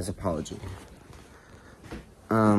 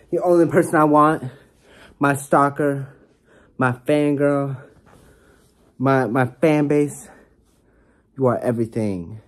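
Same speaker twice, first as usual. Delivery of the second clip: higher and louder, hammed-up adoration; over-the-top gushing; 5 semitones higher, 6 dB louder than usual. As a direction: gush it like a lovestruck fan. Building over-the-top love declaration.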